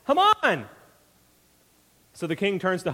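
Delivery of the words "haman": high, loud accusation; wife points out Haman; accusing shout. Acted. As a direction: call out the culprit's name. Esther names the culprit.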